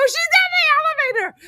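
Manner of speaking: loud, very high retold wail; freaking out. Mom answering her own mother, freaking-out shriek. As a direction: shriek it in mock hysteria. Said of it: "I'm freaking out".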